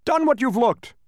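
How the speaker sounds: high, loud indignant outburst; angry shout. A narrator's reading. Salesman yelling over his scrambled words, angry shout. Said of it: "angrily shouted one of the salesmen".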